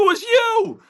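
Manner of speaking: surprised. Retold or imitated. Shocked realisation in story.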